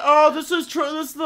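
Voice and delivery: high, loud excited customer voice; rambling customer. Retold or imitated. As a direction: mimic the customer back again with Trudy. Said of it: "they come back".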